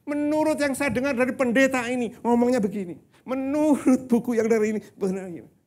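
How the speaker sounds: loud, high-pitched parody of someone citing authorities; mock know-it-all. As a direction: imitate an insistent second-hand expert. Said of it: "Bukankah kita sering begitu".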